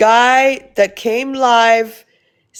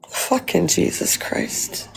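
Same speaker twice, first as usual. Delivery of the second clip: slow, breathless whisper of disgust; whispered disbelief; 2 semitones lower, 9 dB quieter, slower than usual. Real, not acted. Reacting to a creepy viewer comment, slow whispered disbelief.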